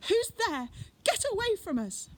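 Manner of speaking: high, loud panicked shout; frightened shout. Acted. Hidden girl shouting a warning at the stranger, panicked yell. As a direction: yell out from hiding in fear.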